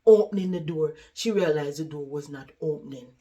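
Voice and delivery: ordinary delivery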